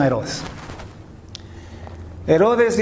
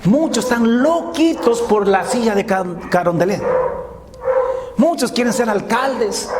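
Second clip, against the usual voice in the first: fiery preaching; 7 semitones higher than usual. Acted. Preacher rousing the brothers against worldly ambition, fiery preaching. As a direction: preach it hard to the congregation.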